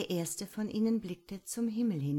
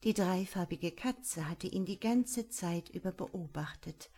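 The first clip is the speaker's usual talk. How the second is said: ordinary delivery, nothing marked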